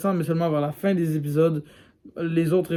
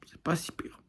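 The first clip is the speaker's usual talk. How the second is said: much softer, whispered repeat; muttered aside; 11 dB quieter than usual. Real, not acted. Vlogger grudgingly conceding to viewers the series is okay, whispered aside. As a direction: whisper it as an afterthought. Same verdict repeated under breath.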